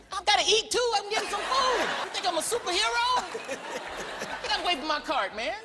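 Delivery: high exasperated complaint; high whiny get away; mock exasperated. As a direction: shoo them off playfully. Answering fans.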